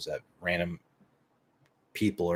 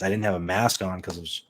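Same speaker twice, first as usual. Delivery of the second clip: normal talking voice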